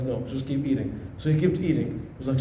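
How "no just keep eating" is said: put-on lower, firm refusal from an old teacher; stern sheikh. Sheikh refusing the thirsty student, low and firm. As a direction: deny him flatly, like a strict sheikh.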